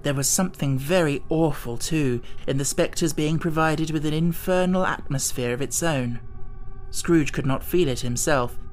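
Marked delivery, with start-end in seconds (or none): none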